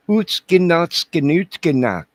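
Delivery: loud, high mock German-Polish babble; mock German-Polish gibberish. Host mimicking foreign chat, mock German-Polish gibberish. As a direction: blurt out fake German or Polish nonsense.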